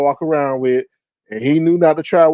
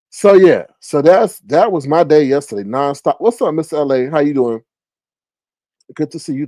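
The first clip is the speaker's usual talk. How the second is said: ordinary talk, nothing marked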